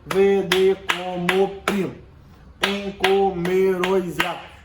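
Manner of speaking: slow, evenly hammered scansion; five measured beats to each line, stopping on the last stressed syllable. Shown as a demonstration. Teacher counting out the poem's beat, syllable by syllable. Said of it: "Então sempre cinco batidas".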